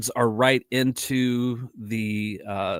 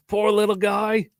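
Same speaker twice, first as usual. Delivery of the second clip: high, badly faked Australian accent; Steve Irwin; 10 semitones higher than usual. Host poking fun at own impression, amateurish Australian accent. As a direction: coo it in a mock Australian accent, pitched up. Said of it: "I can't do an Australian accent".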